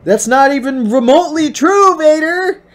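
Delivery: high, loud hammed-up protest; playful fake fury at the villain; mock outraged yell. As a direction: yell it up high like a betrayed hero. Mock Luke outrage at Vader.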